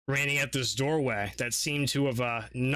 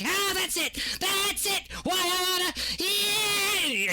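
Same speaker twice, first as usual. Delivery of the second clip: very high, wild cheering voice; ecstatic squeal; slower than usual. Acted. Crazed NPC cheering at the scene, squealing very high. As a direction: shriek it out like a giddy zealot.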